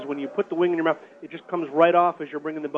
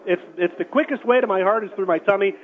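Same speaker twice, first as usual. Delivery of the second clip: normal talking voice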